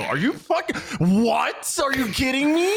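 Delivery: high-pitched incredulous outburst, half laughing; outraged disbelief. Retold or imitated. Gamer hit from behind through the fog wall, outraged. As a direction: squeal in mock outrage at a cheap hit.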